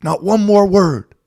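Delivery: high, loud final warning; stern warning. Retold or imitated. Ref ordering the coach to be silent, loud high warning. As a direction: slam the door on the argument. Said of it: "And the ref said".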